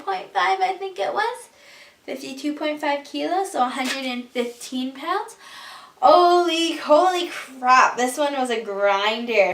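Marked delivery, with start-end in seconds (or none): 6.0-8.0 surprised. Real, not acted. Reacting to a grinding bench lift.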